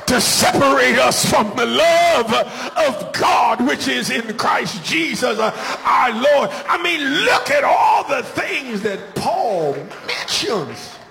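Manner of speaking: high, chanted, shouted sermon climax; full-force preaching. Acted. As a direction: whoop it up over the organ. Answers his cue to shout.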